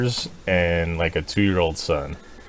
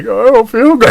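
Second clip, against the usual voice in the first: put-on high, loud whiny kid voice; sick kid whine; 11 dB louder than usual. Sick daughter telling the adults, high whine. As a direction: whine it like a queasy child. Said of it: "So she's like".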